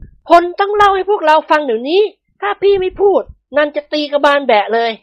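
very high, insistent female voice; threatening wife. A narrator's reading. Nantha threatens her husband with a club, scolding demand.

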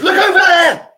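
sudden high yell pointing away; shout. Acted. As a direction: shout it to fool him, very high and loud. Trick to distract the giant.

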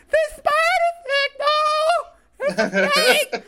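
very high, loud laughing squeal; excited squeal. Retold or imitated. Fan reenacting the Universal ride opening, squealing. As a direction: shriek with childish joy. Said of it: "So I'm just going".

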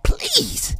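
squeaky high plea to fat friends; begging fat friends in a squeaky high voice; high mock pleading voice. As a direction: plead in a tiny high voice, slow. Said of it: "I'll be like".

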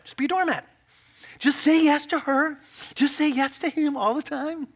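mock the doormat idea; mocking objection.

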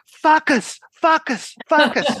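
put-on high-pitched Italian accent yelling "Fuck us"; Italian coach's accent mangling "Focus" into "Fuck us"; Northern Italian accent. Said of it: "in their Northern Italian accent".

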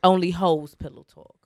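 low, firm growl like Batman; Dark Knight voice. Acted. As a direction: lay it down deep and serious. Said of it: "she said it like Dark Knight".

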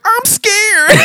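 very high, put-on child voice; scared little kid. Little kid beside him at the fight, mock scared. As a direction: squeak it out like a frightened child, hammed-up.

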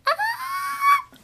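cracking, failed high scream; voice giving out. Shown as a demonstration. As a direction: try to scream but the voice breaks. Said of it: "watch, listen to me try to scream".

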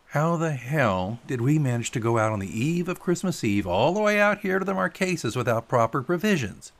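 fed-up fisherman, high and incredulous; exasperated Pilot. Acted. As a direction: gripe in rising disbelief.

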